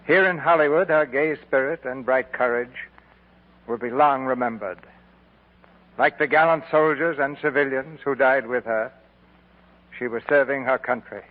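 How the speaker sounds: honor a fallen star with dignity; formal radio eulogy, measured and grave; solemn tribute. Acted. Solemn memorial tribute on air.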